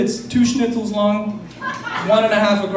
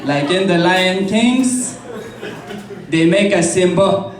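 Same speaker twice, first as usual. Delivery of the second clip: French Canadian narrator; much slower than usual. Retold or imitated. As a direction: stumble through it like GSP narrating. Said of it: "with a French Canadian accent".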